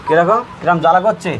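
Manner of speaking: usual speaking voice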